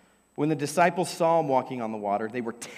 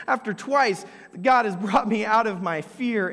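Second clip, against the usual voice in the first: usual speaking voice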